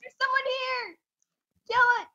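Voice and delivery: high-pitched urgent callout; urgent alarm. Real, not acted. Player warns team of an intruder, urgent alarm.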